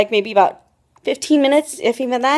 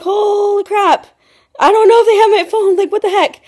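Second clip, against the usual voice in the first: high, louder mock-panicked outburst; acted panic; 10 semitones higher, 6 dB louder than usual. Retelling to viewers the moment they disappeared, high panicked quote. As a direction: replay the panic, pitched way up. Acts out panic at missing phone.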